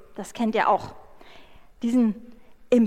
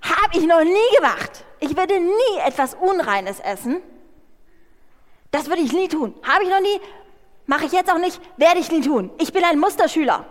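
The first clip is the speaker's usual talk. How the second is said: indignant, higher and louder, insisting on nie; horrified protest; 5 semitones higher, 3 dB louder than usual. Acted. Petrus, appalled, answers God with a louder, higher protest. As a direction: Petrus protests he never ate anything unclean. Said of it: "Petrus ist entsetzt und sagt".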